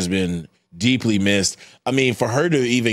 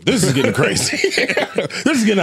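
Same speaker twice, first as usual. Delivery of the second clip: laughing; 8 semitones higher, much faster than usual. Real, not acted. Laughing through his complaint.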